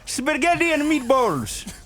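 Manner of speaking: mock Italian diner accent drifting towards Indian; attempted Italian accent. Ordering spaghetti at an Italian restaurant on request, botched Italian accent. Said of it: "ordering spaghetti at an Italian restaurant".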